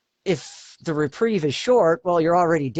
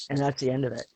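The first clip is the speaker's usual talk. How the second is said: ordinary talk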